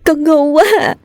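high, tearful wail full of sobs; sobbing. A narrator's reading. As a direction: sob helplessly to mother, scared and lost. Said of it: "nức nở nói". (nức nở, khóc cao giọng)

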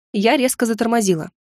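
usual speaking voice

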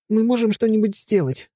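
put-on high hopeful girlish voice; Helga, pleading gently. A narrator's reading. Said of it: "с надеждой спросила она".

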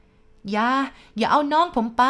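high, loud desperate cry; crying at full voice. A narrator's reading. Boy wakes screaming for his brother, top-of-voice cry. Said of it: "ผมร้องขึ้นสุดเสียง".

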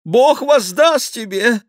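high, loud desperate cry; desperate thanks. A narrator's reading. The despairing king thanking the cardinal, high and desperate. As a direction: clutch at mercy. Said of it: "воскликнул исполненный отчаяния король".

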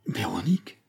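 breathless whispered echo of a name; shocked whisper. A narrator's reading. Gérard, stunned, whispers back the wife's name. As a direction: whisper the name as the blood drains away. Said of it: "répéta Gérard en pâlissant".

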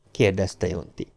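ordinary delivery, nothing marked

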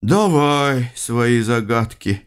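weary, slow sighing; heavy sigh. Acted. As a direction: sigh it out, resigned. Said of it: "тяжело вздохнул Бран".

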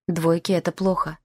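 usual speaking voice

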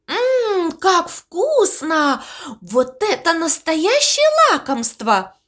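usual speaking voice

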